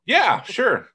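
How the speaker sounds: high-pitched, breezy play-along yes; humoring agreement. Acted. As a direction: go way up, humoring a clueless stranger. Playing along with a mistaken stranger.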